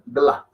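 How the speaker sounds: "Gla" as one syllable; no vowel between g and l in "Gla"; merged Hindi onset. Shown as a demonstration. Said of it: "suena como un gl".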